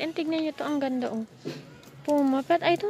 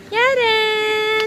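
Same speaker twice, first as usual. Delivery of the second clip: loud call, a bit higher; 7 semitones higher, 8 dB louder than usual. Real, not acted. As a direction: call her name across the ride. Calling daughter on the ride.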